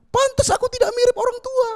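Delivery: put-on shocked little kid. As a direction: squeal like a kid who just learned he was adopted. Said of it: "Pasti bocilnya langsung". (suara bocil kaget melengking tinggi dan keras)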